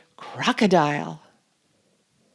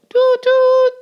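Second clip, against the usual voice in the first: very high, loud toot; train whistle toot; 10 dB louder than usual. Acted. Reader makes the train's whistle, high and loud.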